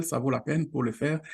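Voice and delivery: usual speaking voice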